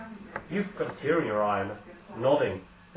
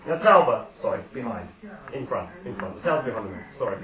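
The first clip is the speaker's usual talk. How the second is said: ordinary delivery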